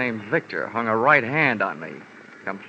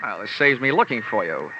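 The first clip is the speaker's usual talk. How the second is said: ordinary talk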